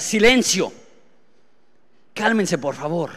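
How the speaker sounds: rebuking the wind. Retold or imitated. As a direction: order the storm to be still. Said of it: "reprendió al viento".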